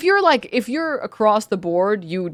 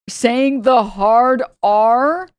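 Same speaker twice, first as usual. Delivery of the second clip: incredulous disbelief; 4 semitones higher, 5 dB louder, much slower than usual. Real, not acted. Incredulous at a grown man.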